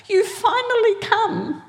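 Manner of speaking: high, laughing cry of relief; overjoyed relief. Retold or imitated. To Jesus after a long wait, a high, laughing joyful cry.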